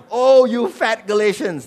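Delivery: loud, high, slow exclamation; mock rebuke. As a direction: cry it out as a mock scolding.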